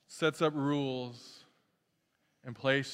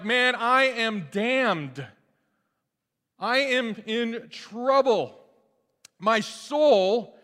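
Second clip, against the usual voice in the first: higher, slow, loud despair; dread; 7 semitones higher, 6 dB louder, much slower than usual. Retold or imitated. Isaiah trembling before God, slow and loud. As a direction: plead in terror of judgment.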